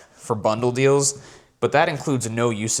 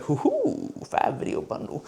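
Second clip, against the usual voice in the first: mock whisper; 9 semitones higher, 6 dB quieter than usual. Gleeful reaction to the client's offer.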